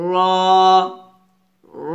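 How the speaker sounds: র thick, with a rounded "aw"; full, dark র; slightly heavy R. Shown as a demonstration. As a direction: put weight into a held র. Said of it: "গাঢ় করে, মোটা করে উচ্চারণ করতে হবে".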